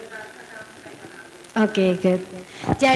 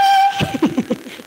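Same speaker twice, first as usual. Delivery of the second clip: high burst of laughter; amused laugh. Real, not acted. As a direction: laugh at the demo. Laughs after the demo.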